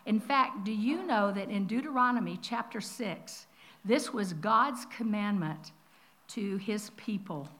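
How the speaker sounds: ordinary talk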